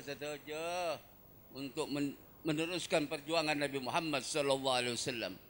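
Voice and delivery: usual speaking voice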